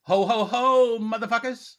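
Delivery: high, loud mock Santa laugh. Cohost greets everyone as a loud mock Santa.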